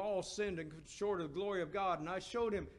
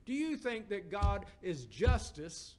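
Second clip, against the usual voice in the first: usual speaking voice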